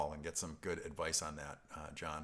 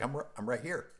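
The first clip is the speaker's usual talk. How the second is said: higher, half-laughing objection; mock protest; 6 semitones higher, 3 dB louder than usual. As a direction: pipe up in higher-pitched mock indignation. Retold protest at being talked about.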